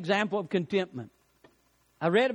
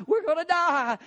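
mock panic, cry it out higher the second time; panicking; 9 semitones higher than usual. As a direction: panicky repeat, pitched higher. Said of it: "he's panicking".

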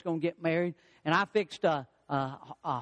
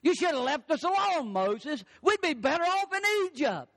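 complaining crowd; 8 semitones higher than usual. Acted. Israelites complaining to Moses.

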